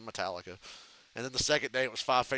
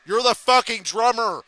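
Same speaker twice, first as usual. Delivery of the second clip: loud, higher and slow mock yell; yelling manager; 8 semitones higher, 10 dB louder than usual. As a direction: bark it slowly at the drummer. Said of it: "And Doc goes".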